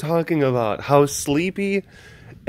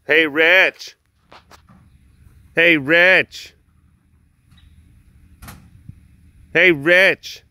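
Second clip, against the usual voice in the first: loud repeated call for attention; 6 dB louder, slower than usual. Real, not acted. Calling to busy Rich to get his attention, loud hail. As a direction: call across to someone who hasn't heard.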